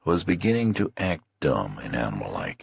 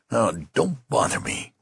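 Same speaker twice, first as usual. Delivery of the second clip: desperate menace; 9 semitones higher than usual. Acted. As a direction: warn him off, desperate. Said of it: "with desperate menace".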